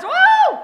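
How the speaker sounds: high, loud joyful whoop; excited whoop. Retold or imitated. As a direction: let out a big celebratory woo. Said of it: "I was so excited".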